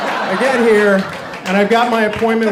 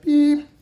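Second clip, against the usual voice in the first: high imitated beep; machine beep; 9 semitones higher, 4 dB louder than usual. Playing back his messages, high beep. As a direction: chirp it like the answering machine.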